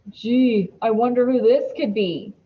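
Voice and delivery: loud, mocking sarcasm; sarcastic eye-roll. A narrator's reading. Dylan mocks the card's obvious answer, loud and sarcastic. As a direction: drip sarcasm, loudly. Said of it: "He rolls his eyes".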